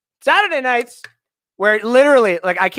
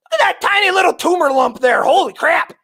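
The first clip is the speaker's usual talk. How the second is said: excited shout; 12 semitones higher, 3 dB louder than usual. Real, not acted. Gawking at viewer's small muscles.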